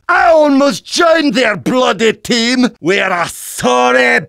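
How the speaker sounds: boozy Scottish soldier bellowing battle cries; Scottish brawler. Acted. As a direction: roar it like a drunken Scot charging into battle.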